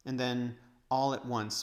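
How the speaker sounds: ordinary delivery, nothing marked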